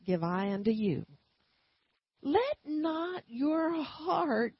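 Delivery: slow, weighty reading of the verse; solemn, slow verse reading from the pulpit; slow scripture recitation. Acted. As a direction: draw out each word of the scripture.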